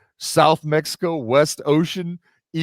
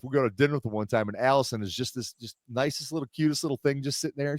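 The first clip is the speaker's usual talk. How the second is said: ordinary delivery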